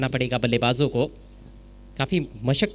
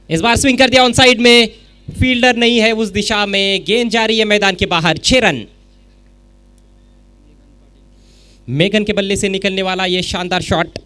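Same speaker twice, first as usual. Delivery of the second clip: much higher, much louder six call; excited cricket commentary; 6 semitones higher, 8 dB louder than usual. Real, not acted. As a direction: roar it as the ball clears the rope. Six hit, commentator erupts. (जोशीली cricket commentary)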